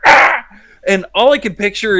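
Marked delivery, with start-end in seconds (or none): 0.1-0.5 sudden loud yelp of a groundhog; groundhog scream. Retold or imitated. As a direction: shout it out like an angry groundhog. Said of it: "You just hear".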